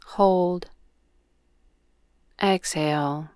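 unhurried yoga nidra guidance with long pauses; guided meditation. Real, not acted. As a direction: guide them gently into breath awareness, leaving space after each cue.

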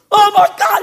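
high, loud hammed-up panic yell; mock scream. Driver panicking over dog in his car, mock scream. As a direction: imitate a man screaming in alarm. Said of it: "he's screaming".

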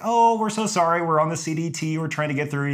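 high-pitched placating apology; apologetic. Retold or imitated. Hiker explaining to angry landowner, sweetly apologetic. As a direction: imitate yourself over-politely smoothing things over.

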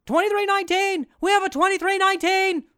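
very high, loud alarm call; shrill-pitched hammed-up alarm shout; panicked yelling. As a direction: yell it like an emergency alert. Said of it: "I just started yelling twenty-three nineteen".

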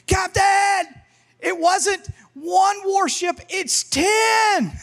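very high, stretched lookout's shout; frantic hollering. Acted. Lookout in the crow's nest warns captain, hollering. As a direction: holler it down from the mast, dragging the last words. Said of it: "he hollers down".

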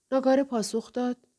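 plain reading voice

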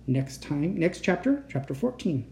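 ordinary delivery, nothing marked

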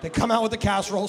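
ordinary delivery

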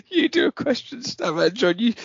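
high giggly words; barely holding the laugh in; laughing while talking. Real, not acted. Still laughing.

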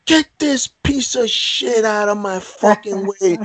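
high contemptuous bark of orders; angry drill sergeant. Retold or imitated. Drill sergeant ordering the fainted recruit dragged off, high angry bark. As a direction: snarl it like a disgusted sergeant.

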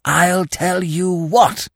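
drawn-out hoarse whispering. A narrator's reading. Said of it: "repeated Freddy in a hoarse whisper".

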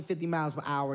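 ordinary talk

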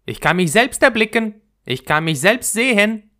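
self-admiring flower, high and loud; vain narcissus. A narrator's reading. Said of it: "sagte die Pfingstlilie".

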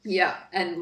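ordinary talk, nothing marked

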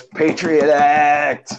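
drawn-out, gleeful movie-line imitation; Alec Baldwin quote. Film fan quoting an actor's over-the-top line, hammed-up exclamation. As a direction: stretch each word like a slow, hammed-up cheer. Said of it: "he walks up to the guy and he goes".

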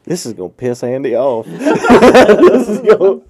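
high laugh spilling into words; cracking up mid-sentence; real laughter, loud and high. Real, not acted. Laughing at own prank.

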